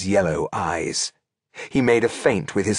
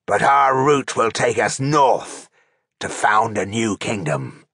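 slow, orcish war speech to the troops; proclaiming orc chieftain; 3 semitones higher than usual. A narrator's reading. Orc chieftain rousing his tired troops for battle, proclaiming. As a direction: proclaim it slowly as an orc warlord. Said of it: "he proclaimed".

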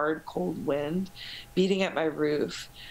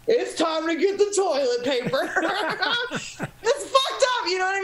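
laughing; 11 semitones higher than usual. Retold or imitated. Retold own panic, laughing.

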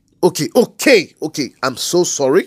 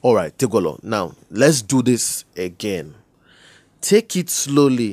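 calm; 8 semitones lower, 4 dB quieter than usual. Acted. Caller calming down, restarting slowly.

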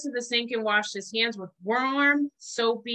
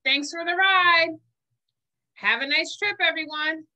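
higher, bright little germ voice; a tiny germ calling out thanks; cheery germ; 7 semitones higher than usual. Acted. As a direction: wave goodbye as a mischievous germ. Germ voice leaving.

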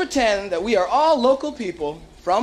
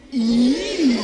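mainland disgust; 3 semitones higher than usual. Shown as a demonstration. Escort shows tourists the mainland reaction of disgust. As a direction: give a big disgusted "Ew". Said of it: "say it with me".

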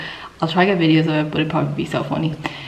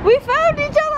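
very high, thrilled squeal; excited squeal. Real, not acted. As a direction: squeal it with joy. Reunion with friend, squeal.

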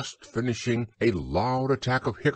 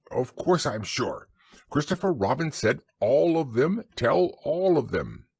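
Owl voice; 6 semitones higher than usual. A narrator's reading.

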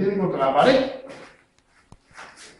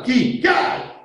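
kiai shout; 6 semitones higher than usual. Shown as a demonstration.

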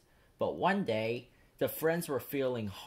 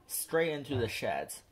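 ordinary delivery

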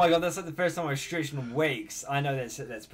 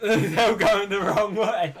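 slow, higher, loud words through laughter; laughing while talking; 4 semitones higher, 6 dB louder, slower than usual. Real, not acted. Viewer amused by their wrong turn, laughing. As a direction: crack up while saying it. Cracking up at others' mistake.

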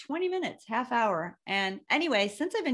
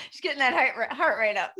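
laughing; 3 semitones higher, 6 dB louder, faster than usual. Real, not acted. Laughing about wife's speed.

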